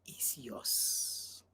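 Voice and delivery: very soft, low whisper; hushed warning. Real, not acted. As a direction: let it sink to a whisper.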